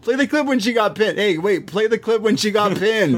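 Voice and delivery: high, teasing impression of Beth; mock Beth voice. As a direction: needle her like a smug rival. Beth voiced mocking Io in recap.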